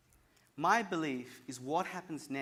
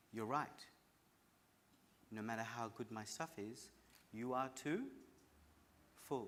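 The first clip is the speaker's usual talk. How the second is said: low, soft, calm reply; calm monk; 4 semitones lower, 9 dB quieter than usual. Retold or imitated. Monk finally answering the angry psychiatrist, low and calm. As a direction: say it gently like a wise monk. Wise monk speaks calmly.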